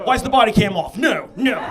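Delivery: loud comic protest; mock alarmed shout. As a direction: call out in exaggerated alarm. Acted alarm at the cop.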